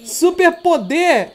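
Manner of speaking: slow, deliberate "Super poder!"; slow careful correction. Real, not acted. Father fixes his slip, saying "Super poder!" slowly.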